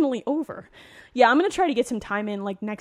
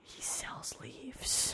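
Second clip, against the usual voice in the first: whisper; 3 semitones higher, 15 dB quieter, much slower than usual. Real, not acted. Unvoiced whispered joke.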